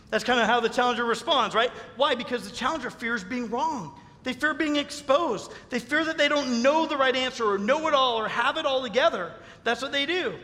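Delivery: usual speaking voice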